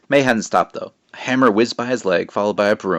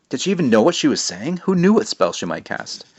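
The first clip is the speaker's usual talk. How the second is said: ordinary delivery, nothing marked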